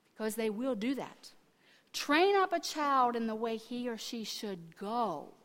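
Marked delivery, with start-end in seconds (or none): none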